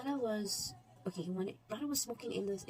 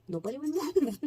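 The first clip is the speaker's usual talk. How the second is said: laughs mid-line, higher and louder; laughing; 6 semitones higher, 7 dB louder than usual. Real, not acted. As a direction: break into a laugh on the repeat.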